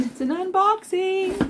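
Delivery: excited. Real, not acted. Announcing her yarn unboxing.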